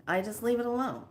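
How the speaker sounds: ordinary delivery, nothing marked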